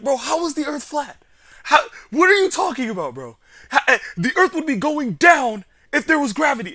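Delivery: loud, high mock-nerd rant; squeaky, shouted imitation of an incredulous gamer; virgin voice. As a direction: impersonate a dork roasting someone, exasperated. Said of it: "that fucking virgin voice".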